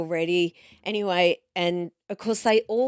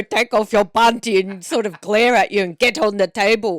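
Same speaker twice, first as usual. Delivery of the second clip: glaring doctor; 6 dB louder than usual. Retold or imitated. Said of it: "you don't go".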